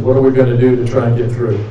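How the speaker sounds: ordinary talk, nothing marked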